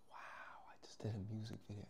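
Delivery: quick whispered disbelief; very soft, reenacted wonder; hushed amazement. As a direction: whisper it to yourself in awe. Retold private amazement at home.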